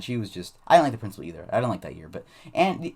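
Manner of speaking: plain reading voice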